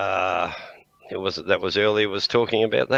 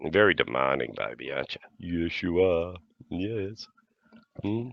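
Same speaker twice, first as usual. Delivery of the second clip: pet talk; 4 dB quieter than usual. Real, not acted. Baby talk to the dog.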